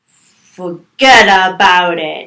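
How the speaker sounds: loud, slow Italian-American "forget about it"; attempted Italian-American accent. Shown as a demonstration. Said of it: "hear it and then mimic it".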